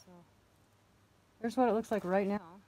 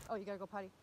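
normal talking voice

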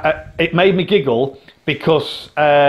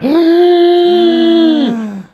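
loud high shocked gasp; big theatrical gasp; mock audience gasp; 13 semitones higher, 6 dB louder than usual. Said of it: "the whole place went".

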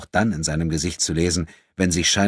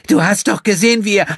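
high, loud indignant outburst; protesting; 10 dB louder than usual. A narrator's reading. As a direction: flare up in defiance. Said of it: "begehrte Gina auf".

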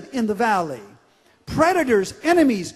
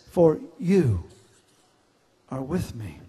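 hushed worship; 9 semitones lower than usual. Acted.

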